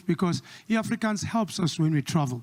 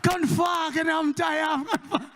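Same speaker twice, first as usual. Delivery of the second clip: eager pleading; 9 semitones higher than usual. Retold or imitated. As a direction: beg to be let in on the millions. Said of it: "I'm like".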